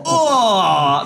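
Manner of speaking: loud, high drawn-out moan; hammed-up climactic "oh"; mock moan. Acts out the caller's climax.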